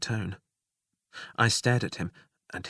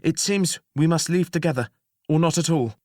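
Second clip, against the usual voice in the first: raised, firm; resolved; 6 semitones higher, 4 dB louder than usual. Acted. The two goblins answering the jailer firmly. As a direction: state it resolutely. Said together.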